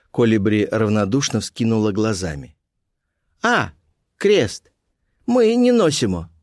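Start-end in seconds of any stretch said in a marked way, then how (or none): 3.4-6.3 put-on very high-pitched foreign girl; Colibri's voice. A narrator's reading. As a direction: pitch it right up, falling at phrase ends. Colibri's broken Russian reply.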